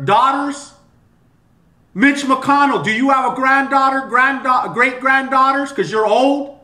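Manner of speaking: high, loud furious yelling at politicians; angry shouting rant. Real, not acted. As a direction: shout it out, outraged at congress.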